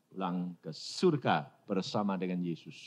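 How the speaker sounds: barely there, gently saying it; soft child voice. Retold or imitated. Dying daughter answers her family, very soft and low.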